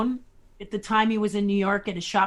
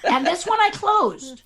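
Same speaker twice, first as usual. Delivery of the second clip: laughing; 10 semitones higher, 6 dB louder than usual. Real, not acted. Joking about refusing Henson.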